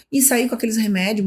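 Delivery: usual speaking voice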